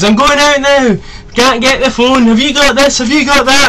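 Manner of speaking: high, loud imitation of family calling upstairs. Mocking his family interrupting his videos, high and loud. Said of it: "All you hear in my videos is".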